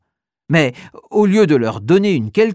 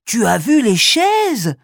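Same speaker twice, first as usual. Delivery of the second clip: awestruck; 6 semitones higher, slower than usual. Acted. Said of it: "ajoute Oscar, ébahi".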